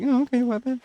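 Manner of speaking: high-pitched, put-on "whatever" voice; mock dismissive. Showing the tone meant as not aggressive, mock dismissive. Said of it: "I'm just being kind of like".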